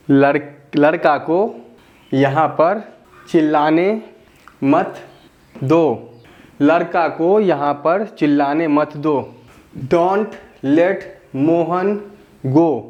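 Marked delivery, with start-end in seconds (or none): none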